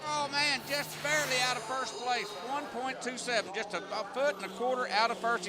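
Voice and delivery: high, excited close-call call; excited announcer. Real, not acted. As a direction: pitch up as it just misses first. Close finish, just missing first place.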